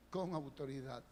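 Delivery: "Con" stressed, falling and soft; soft emphatic answer. Shown as a demonstration. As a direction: land on "Con autoridad" quietly. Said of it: "¿Cómo era la palabra". ("Con" acentuado, en voz baja)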